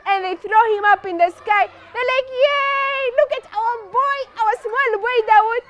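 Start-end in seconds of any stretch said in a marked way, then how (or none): 2.3-5.6 high-pitched cheering; proud celebration. Acted. Crowd throwing Dawood in the air, jubilant. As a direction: crow over your own hero.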